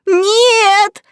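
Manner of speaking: high, loud wail of refusal; howling cry. A narrator's reading. The twins howl to stop Narcissus, desperate wail. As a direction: cry out, stretching the word high. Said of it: "Хором взвыли близнецы".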